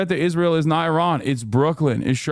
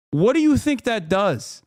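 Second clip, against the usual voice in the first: exasperated question; 8 semitones higher than usual. Acted. Exasperated question to the imagined person.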